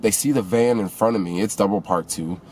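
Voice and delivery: ordinary delivery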